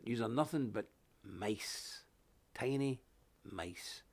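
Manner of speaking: slow, quiet contempt; scornful put-down, dropping quiet. Acted. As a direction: belittle them, voice sinking low. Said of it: "I will say this to them".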